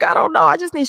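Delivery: high, choked-up pleading; teary. Retold or imitated. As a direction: still half crying, reaching for words. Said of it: "Now I'm just buku cry".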